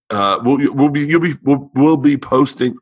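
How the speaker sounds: tired, stumbling stutter. Real, not acted. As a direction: trip over the words, worn out. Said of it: "I am, like, stuttering and tired".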